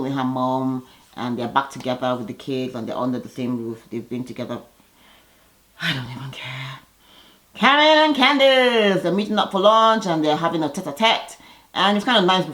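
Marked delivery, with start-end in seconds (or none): 5.8-6.8 soft unvoiced throwaway; whispered aside. Real, not acted. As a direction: mutter it under your breath. Dismissive aside.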